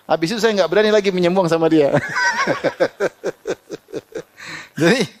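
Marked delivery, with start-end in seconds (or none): 2.0-4.2 high burst of laughter. Real, not acted. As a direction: let out a high, delighted laugh. Laughs after punchline.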